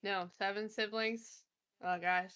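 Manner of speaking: normal talking voice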